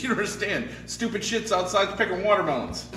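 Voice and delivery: high, loud punchline delivery; hysterical joke character. Acted. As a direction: cry it out amid helpless laughter. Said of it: "They're laughing".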